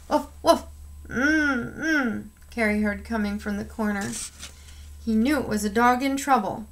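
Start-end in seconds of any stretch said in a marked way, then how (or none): 0.1-2.3 high whimpering woofs; trapped dog whimpering in the cellar. A narrator's reading. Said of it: "heard a whimper".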